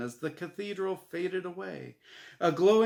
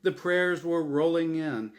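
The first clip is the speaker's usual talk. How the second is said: usual speaking voice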